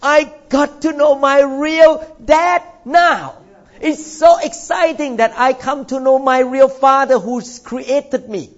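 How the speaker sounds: ordinary talk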